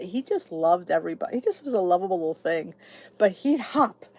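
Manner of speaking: ordinary delivery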